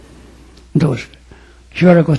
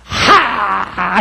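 tearful sigh; 3 semitones higher than usual. Retold or imitated. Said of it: "一絞り絞ったと思ったら".

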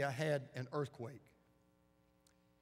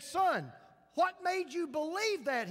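much higher and louder, amazed; father asking; 12 semitones higher, 6 dB louder, slower than usual. Retold or imitated. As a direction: ask in wonder, voice raised.

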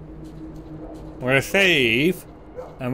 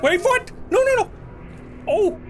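much higher panicked cries; 13 semitones higher, slower than usual. Real, not acted. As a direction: yelp in real panic mid-fight. Panicked firefight shouts.

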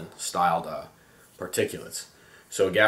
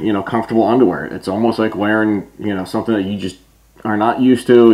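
muffled behind full face mask; speaking inside sealed respirator; through a gas mask; 3 semitones higher, 6 dB louder than usual. Real, not acted. Seen 2: Full gas mask with filter on face throughout; references show bare face.

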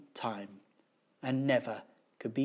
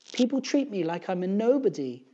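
high, louder complaining teacher voice; higher-pitched put-upon schoolteacher; aggrieved teacher; 8 semitones higher, 7 dB louder than usual. A narrator's reading. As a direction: voice an upset teacher airing a grievance. Upset teacher complains to three men.

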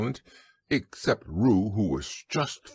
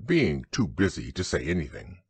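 usual speaking voice

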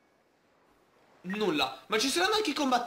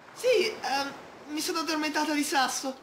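character voice; 7 semitones higher than usual. Acted. Voicing sleepy character reply.

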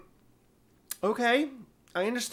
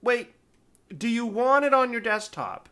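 higher, hurried interjection; retold self; 8 semitones higher, 4 dB louder than usual. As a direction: stop them mid-click, lightly. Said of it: "And I'm like".

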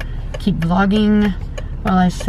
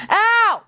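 high pained "ow" as the puppy bites; startled high ouch; sharp yelp; 13 semitones higher than usual. Real, not acted. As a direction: yelp at a nip.

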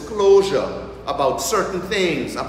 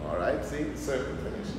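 quiet aside; 4 semitones lower, 10 dB quieter than usual. Real, not acted. Aside to the congregation.

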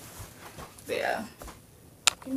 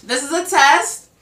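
loud announcing; 6 semitones higher, 13 dB louder than usual. Real, not acted. Third mic check.

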